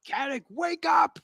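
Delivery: high, urgent call to wake up; in-character shout. Acted. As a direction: cry out to rouse a comrade. Player calls out in character.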